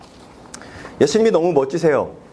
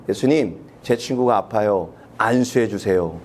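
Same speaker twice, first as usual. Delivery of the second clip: low, slow, matter-of-fact request; dull demand; 8 semitones lower than usual. Pretend. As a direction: state the order plainly and slowly. People's flat demand. (낮고 느리게 무덤덤하게 요구)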